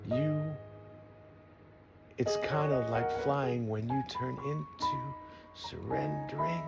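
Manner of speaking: slow, lofty spoken-word delivery; spoken word poem. Acted. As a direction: recite it like a poem. Poetic spoken-word over music.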